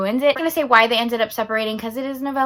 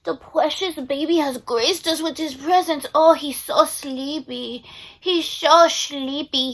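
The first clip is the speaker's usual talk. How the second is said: soft, slow cooing at the dog; tender pet voice; 3 semitones higher, 4 dB quieter, slower than usual. Real, not acted. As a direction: coo over a sleepy puppy. Cooing over her sleepy dog.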